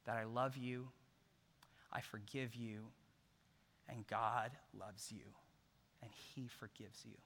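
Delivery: soft, near-whispered forgiveness, fading away; hushed forgiveness. Retold or imitated. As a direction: tell her gently, almost breathing it. Wife forgiving the thief tenderly.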